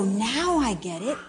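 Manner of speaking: slow, gentle confession; slow realization. Retold or imitated. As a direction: say it slowly as if just understood. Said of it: "And when you go".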